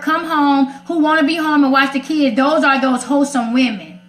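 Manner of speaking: normal talking voice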